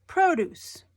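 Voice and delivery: PRO-duce, high and loud on PRO; stress on first syllable. Shown as a demonstration. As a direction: hit the PRO of Produce. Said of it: "is in the first syllable".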